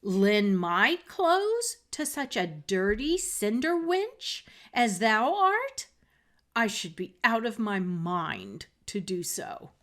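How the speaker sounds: haughty stepsister scolding Cinderella loudly; scornful outburst. Acted. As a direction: spit out the insult loudly. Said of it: "cried Miss Charlotte".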